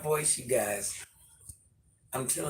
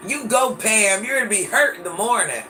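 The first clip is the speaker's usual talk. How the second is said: louder, higher cheer for Pam; 5 semitones higher, 8 dB louder than usual. Real, not acted. Cheering Pam on from the live chat, loud and high. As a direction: whoop it up for her.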